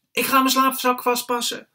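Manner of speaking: high, loud, gleeful announcement; excited child shout. A narrator's reading.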